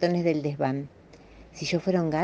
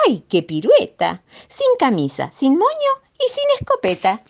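louder, higher, building to a squeal; playful climax that climbs very high by the end; comic exclamation; 9 semitones higher, 6 dB louder than usual. A narrator's reading. Reader tells children of the hunter stripped by a hare, comic exclamation.

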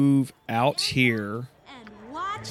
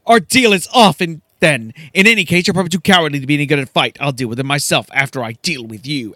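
louder, scornful dismissal; a scorned noblewoman turning on her hired help, louder; angry Silky; 6 dB louder, much faster than usual. Acted. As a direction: spit the insult and call off the deal. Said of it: "She says".

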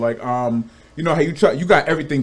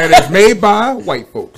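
slow, louder laughing ad tagline; infomercial; 5 semitones higher, 7 dB louder, slower than usual. Pretend. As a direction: deliver it as an ad slogan. Mock ad tagline.

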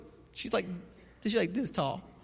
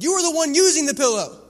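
higher, louder pointed comeback; wife's retort; 10 semitones higher, 10 dB louder than usual. Retold or imitated. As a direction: pin the blame on him. Wife retorts.

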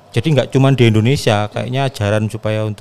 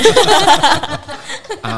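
loud high laugh; 5 dB louder than usual. Real, not acted. Laughing after joke.

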